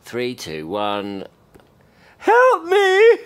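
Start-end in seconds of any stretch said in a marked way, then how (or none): 2.2-3.2 very high, loud pretend scream; hammed-up yell for rescue; mock cry for help. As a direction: shout it for the recording, pitched way up. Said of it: "I'm just gonna go".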